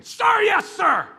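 loud barked military reply; drill-ground shout of obedience; shouted sir yes sir. Retold or imitated. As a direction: snap it out like a recruit to his general. Drill response to a general.

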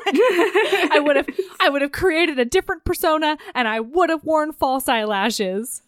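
amused, laughing retelling. Real, not acted. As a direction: repeat it through laughter. Laughing while repeating a quote.

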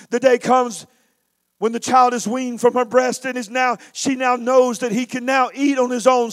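normal talking voice

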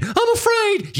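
mock-terrified squeal, very high; 1 squealing coward. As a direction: shriek it like a cornered coward. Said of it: "he's like".

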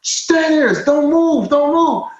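high-pitched repeated commands; urgent police orders. Retold or imitated. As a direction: imitate officers ordering someone to freeze. Officers' commands in retold standoff.

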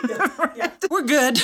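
high-pitched, laughing all through. Real, not acted. Host laughing along with the guest about Uncle Frank. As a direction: laugh the words out, amused.

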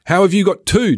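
imitating jealous men calling across the street; mock jealous call. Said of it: "jealous men would call".

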